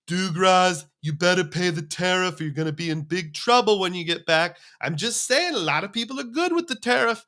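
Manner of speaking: mock presidential impression, rising high toward the end; hammed-up imitation of a politician's warning; President Trump. Imagined president warning a traveler about the tariff, mock-scolding. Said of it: "I had President Trump in my head".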